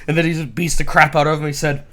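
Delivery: usual speaking voice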